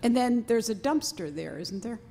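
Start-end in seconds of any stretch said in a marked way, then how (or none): none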